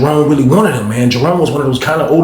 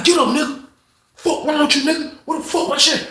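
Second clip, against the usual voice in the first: a much higher mock yell at the man on the floor; angry yell; 4 dB quieter than usual. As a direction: demand it while he's down. Jerome yells during the beating.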